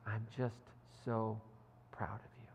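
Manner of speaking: low, halting, fading almost to a whisper; tender pride. Retold or imitated. Rebecca hugging Keeley, tender and proud. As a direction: softly imitate a moved mentor, pausing between phrases. Tearful hug, retold praise.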